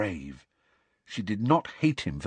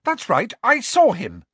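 louder, fierce Cho; 13 semitones higher, 8 dB louder than usual. Acted. Cho standing up to the caretaker, fierce and louder. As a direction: hold your ground fiercely. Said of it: "she said fiercely".

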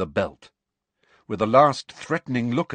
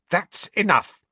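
high, firm and forceful; 11 semitones higher, 4 dB louder than usual. A narrator's reading. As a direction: put your foot down hard. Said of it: "Hermione said forcefully".

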